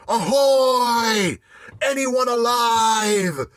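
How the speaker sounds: high, loud hail, drawn out; calling out. A narrator's reading. Captain calling for survivors in the smoke, long loud hail. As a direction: call hopefully into the smoke. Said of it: "calling into the smoke".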